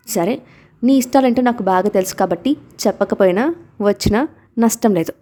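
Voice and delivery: usual speaking voice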